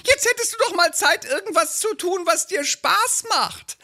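hohe, drängende Ehefrauenstimme; nörgelnde Ehefrau. Acted. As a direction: den Mann antreiben. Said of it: "so ein Lieblingstext". (Ehefrau drängt ihren Mann, hohe Stimme)

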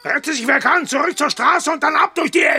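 frantic shouted command; loud panicked order. Acted. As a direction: call the retreat loud. Boss orders retreat.

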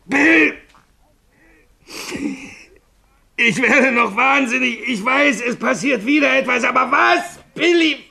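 very high, loud panicked crying out; hysterical despair. Acted. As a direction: lose control, building to a shriek. Haunted man going mad. (hysterisch, verzweifelt schreiend)

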